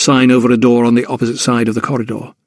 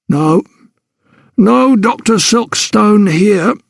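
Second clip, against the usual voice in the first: dismissive porter; 9 semitones higher, much slower than usual. Acted.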